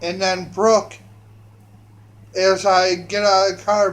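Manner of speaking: normal talking voice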